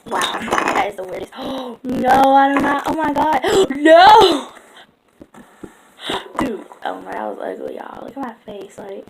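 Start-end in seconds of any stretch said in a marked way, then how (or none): 1.3-4.5 gasping, rising alarm, loud no; real panic as the slime goes wrong; shocked gasps. Real, not acted. Vlogger reacting to slime breaking on camera, gasping and alarmed.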